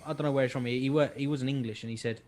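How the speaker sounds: usual speaking voice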